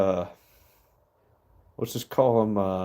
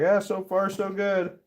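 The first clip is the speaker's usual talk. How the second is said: higher, breezy yelled-back reply; yelled punchline; 10 semitones higher than usual. Retold or imitated. Falling jumper calling back up to the window, breezy yell. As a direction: toss it back up the building like all is fine. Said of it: "guy yells back".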